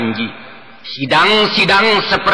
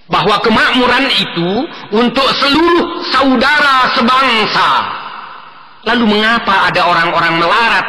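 dramatic recital; 8 semitones higher than usual. Acted. Impassioned poetry recital, rising questions.

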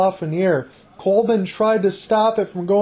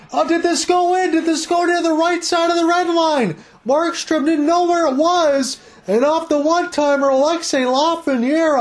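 high-pitched, urgent burst of play-by-play; excited goal call; 8 semitones higher than usual. Real, not acted. Commentator calling the Rangers' tying goal live, excited. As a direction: erupt as the puck may have crossed, easing as it's confirmed.

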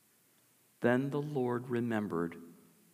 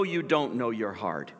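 ordinary talk, nothing marked

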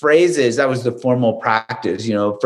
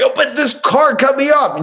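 whiny complaint; 13 semitones higher than usual. Retold or imitated. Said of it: "I'm like".

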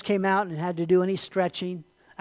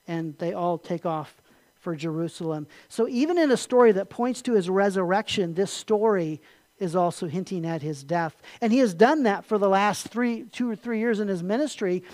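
ordinary talk, nothing marked

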